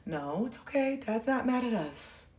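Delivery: put-on soft, slowed comfort to a child; quiet, tender reassurance; soothing reassurance. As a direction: hush a worried little one, gently. Reassuring her worried inner child.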